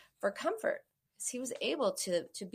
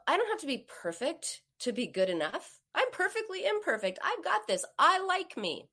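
higher, bright cheerful self-encouragement; peppy pep talk to yourself; upbeat self-talk; 6 semitones higher than usual. Shown as a demonstration. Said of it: "Tell yourself".

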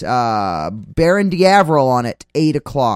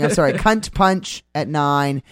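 ordinary delivery

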